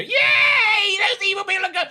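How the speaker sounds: high, loud mocking cheer; exaggerated squealed celebration, mocking opponents. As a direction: imitate the other side whooping in triumph. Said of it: "people on Nick Briggs' side of the fence go".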